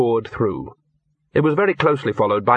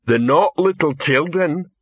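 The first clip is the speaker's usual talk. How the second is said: slow, higher troll grumble; muttering troll; 5 semitones higher, slower than usual. A narrator's reading. Troll disappointed at what was dug out, mild grumble. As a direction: grumble it in drawn-out troll speech. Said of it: "muttered the one who had been complaining".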